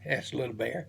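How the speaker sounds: plain reading voice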